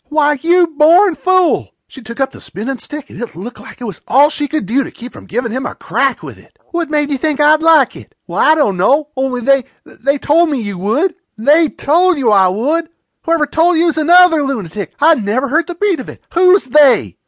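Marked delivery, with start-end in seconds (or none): 0.1-1.7 loud, very slow and high outraged scold; furious scolding. Acted. Aunt scolds the cheeky stranger. 6.7-17.2 higher, louder indignant questioning; outraged aunt. Acted. Aunt rages at kissing stranger.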